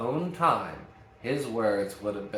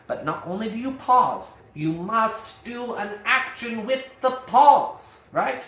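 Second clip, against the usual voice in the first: higher and louder, slowing on action; emphatic teaching; 10 semitones higher, 6 dB louder than usual. Real, not acted. Acting teacher urging students to act during pauses, emphatic. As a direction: drive the point home to the class. Teacher stressing key point.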